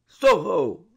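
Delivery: a high, loud roar into the night; roaring call. A narrator's reading. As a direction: sing out as loud as he can. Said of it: "as loud as he could roar".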